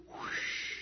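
long airy whoosh; imitated whoosh. Shown as a demonstration. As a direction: blow a rushing-air sound. Imitates passing through with noise.

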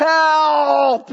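high, sustained shout for help; demonstrative cry for help, pitched high; crying out from the gut. Shown as a demonstration. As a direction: call it out long from the gut. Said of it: "just from the gut".

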